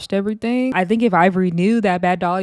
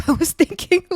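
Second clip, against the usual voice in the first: high-pitched words through real laughter; laughing; 10 semitones higher, 3 dB louder than usual. Real, not acted. Confessing a dark thought about the girls, really laughing.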